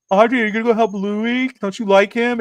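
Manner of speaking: high, singled-out teasing of a crush; teasing friends. Retold or imitated. Schoolgirls teasing the daughter about Louis, high mocking voice. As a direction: imitate kids needling their friend.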